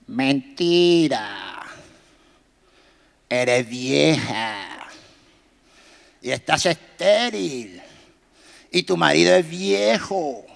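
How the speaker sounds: low, slow devilish voice. Acted. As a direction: drag out each word like a tempter. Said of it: "porque así habla el diablo". (voz grave y lenta del diablo)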